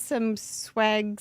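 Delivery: usual speaking voice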